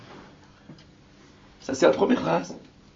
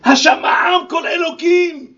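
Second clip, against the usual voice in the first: slow proclaiming shout; 5 semitones higher, 6 dB louder, slower than usual. Acted. Proclaims the verse.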